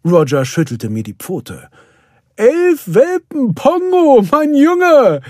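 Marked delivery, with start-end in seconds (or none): 2.4-5.2 very high, loud, drawn-out cheer; jubilant shout. A narrator's reading. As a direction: cheer slowly and loudly in delight. Roger rejoices over eleven puppies. (jubelnd rufend)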